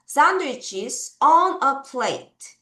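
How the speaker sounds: very slow "Sandwiches on a plate", word by word; slow lead reading; slow choral reading. Shown as a demonstration. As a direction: read along slowly with the class. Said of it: "같이 읽어요".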